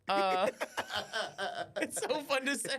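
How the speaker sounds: high laughter through the words; laughing. Real, not acted. Laughing at the pimp bit with co-host. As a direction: giggle it out high.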